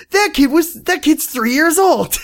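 high-pitched, drawn-out disbelief; incredulous. Retold or imitated. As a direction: imitate someone blurting in astonishment. Quoting shocked girlfriend.

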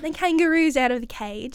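light, playful Australian accent. Shown as a demonstration. Showing co-host an accent on request, Australian accent. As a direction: put on an Aussie accent. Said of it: "I'll do it in an accent".

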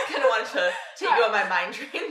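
talking through loud laughter; laughing. Real, not acted. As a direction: still cracking up while speaking. Laughing fit after slip.

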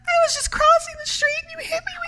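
very high, whiny, wounded wail; milk the fake injury for all it's worth; hammed-up victim. Mouse fakes being hit and accuses the driver, hammed-up.

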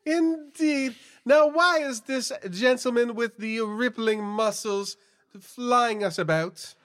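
put-on high, fluttery old woman; old lady voice. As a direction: gush like a genteel old lady. Two old ladies chatting.

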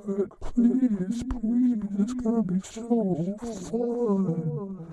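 very high sing-song wheedling wife voice; high pleading housewife. Acted. Wife begging husband to drink dish soap, squeaky high voice. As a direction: coax your husband in a squeaky sweet voice.

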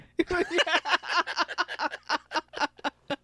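loud high laugh. Real, not acted. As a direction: laugh at your own joke. After own punchline.

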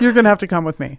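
cop voice. Pretend. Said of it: "in movies where they're like".

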